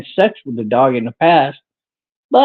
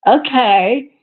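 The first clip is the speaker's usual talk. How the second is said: mock reply; 8 semitones higher than usual. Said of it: "then he answers himself".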